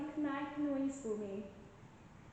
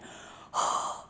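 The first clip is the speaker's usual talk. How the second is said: breath huff. Shown as a demonstration. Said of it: "Let me do that again".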